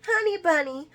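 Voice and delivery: high sugary coo; cooing voicemail. Retold or imitated. Lucy's voice message to Martin, high and cooing. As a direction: sweet-talk the answering machine.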